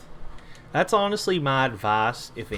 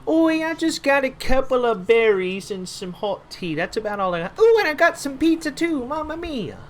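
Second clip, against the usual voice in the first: hammed-up Italian-British NPC voice, much higher and louder; a pleading quest-giver in a silly Italian-British accent, pitched way up; comic half Italian, half British villager; 13 semitones higher, 7 dB louder than usual. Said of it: "I made him half Italian and British".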